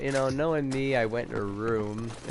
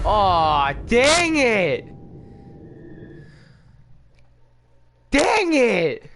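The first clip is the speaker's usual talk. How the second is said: loud, high-pitched yell of frustration; real angry shout; 10 semitones higher, 6 dB louder, much slower than usual. Real, not acted. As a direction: yell it out, furious at the game. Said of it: "I'm pretty mad".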